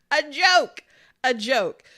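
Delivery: loud, high, incredulous dismissal; loud scorn. Real, not acted. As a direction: blurt it out in disbelief, punching each word. Scoffing at the vice president pick.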